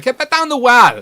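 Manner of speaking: high, loud mock command; mock shout. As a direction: bark it like a banishment. Telling a troll off.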